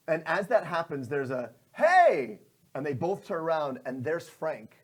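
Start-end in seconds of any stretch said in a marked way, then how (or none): 1.7-2.4 high, loud hail; calling out. Acted. Frank calling to the villains with his lantern. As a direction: shout to grab attention.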